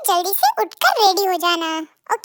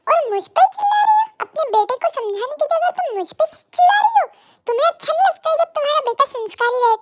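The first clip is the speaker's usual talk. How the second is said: indignant shout; 6 semitones higher than usual. Acted. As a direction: shoot back at her, outraged. Father yells back at mother.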